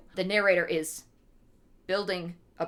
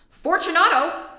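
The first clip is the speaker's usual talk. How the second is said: loud, higher call of the name; calling aloud; 10 semitones higher, 9 dB louder than usual. Acted. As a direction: call into the wall. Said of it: "I called aloud".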